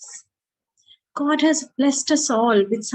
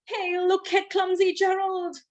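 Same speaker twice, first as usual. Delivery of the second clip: high teasing jeer; mocking animals; 7 semitones higher than usual. Jungle animals taunting Gerald, a high mocking jeer. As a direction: jeer like a bully in the crowd.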